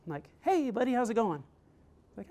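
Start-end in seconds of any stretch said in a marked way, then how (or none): 0.4-1.4 put-on high, friendly phone greeting to a kid; upbeat dad greeting. Dad calling his son from a hotel, upbeat and high-pitched. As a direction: greet your little boy cheerily, pitched up.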